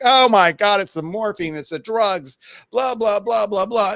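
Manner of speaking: mock panic. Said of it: "one of the person's like".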